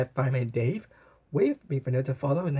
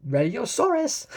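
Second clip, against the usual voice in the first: higher, louder and slow; roaring call; 10 semitones higher, 4 dB louder, slower than usual. A narrator's reading. Rotosaurus roaring ready, loud and slow. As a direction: roar it out proudly. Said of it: "roared Rotosaurus".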